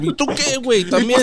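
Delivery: imagine him turning on you next; mock challenge. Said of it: "be like".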